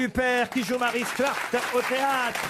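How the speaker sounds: high, excited quiz host voice; celebratory game-show reveal; triumphant confirmation. Real, not acted. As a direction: crow the right answer, pitch up. Quiz host confirms right answer.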